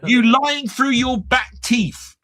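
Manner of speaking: high, slow outraged accusation; half-shouted, drawn-out comic outrage; mock shouting. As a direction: call out a liar on the screen. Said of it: "I'm shouting at the screen".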